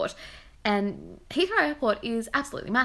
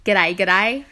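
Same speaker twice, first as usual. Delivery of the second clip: mock Aussie accent; 9 dB louder than usual. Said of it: "you're emphasizing the Aussie accent".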